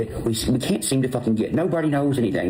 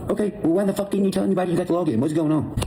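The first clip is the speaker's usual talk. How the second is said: retold angry demand; 6 semitones higher, faster than usual.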